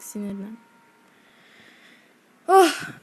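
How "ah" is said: sharp loud higher "ah" of frustration; frustrated cry. Real, not acted. Gamer hit by an enemy, frustrated cry. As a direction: bark out a short angry cry.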